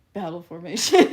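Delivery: loud read through laughter; laughing read. Real, not acted. Reading Trump's chat message while laughing. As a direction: burst out laughing mid-read.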